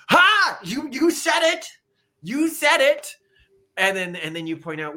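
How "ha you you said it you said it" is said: high, loud triumphant pounce; gleeful gotcha. Acted. Catching someone admitting a duty, triumphant. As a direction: crow it like you caught them out. Acted gotcha in imagined debate.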